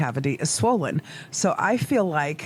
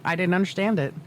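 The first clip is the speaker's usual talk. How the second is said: ordinary delivery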